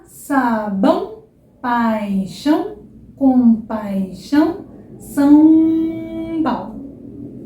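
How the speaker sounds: very slow words, nasal ão each time; slow ão drill. Shown as a demonstration. Teacher running the ão word drill slowly. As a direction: stretch every ão through the nose. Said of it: "questão de praticar".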